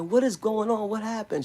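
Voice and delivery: high-pitched imitation of an alarmed mother; nervous. Mother reacting to the commotion, retold nervous questions. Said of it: "She nervous".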